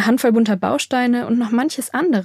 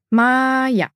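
"Maya" stretched into two syllables, higher; slow teaching; 4 semitones higher than usual. A narrator's reading. Maya teaches Borg her name, "Maya" drawn out.

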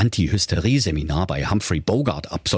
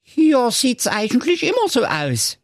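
put-on high, thin old nanny goat; a grateful old goat's voice; frail breathless old person.

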